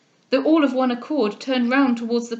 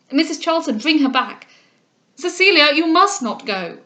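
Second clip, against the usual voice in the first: higher, impassioned pleading; vehement; 4 semitones higher, 6 dB louder than usual. A narrator's reading. Groom begs his departing bride to turn back, vehement. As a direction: implore her urgently, voice raised. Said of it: "cried Delvile with vehemence".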